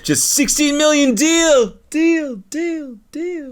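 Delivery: high, slow braggart drone; sing-song smug flex into the void; mocking show-off voice. Mocking flexer in comments.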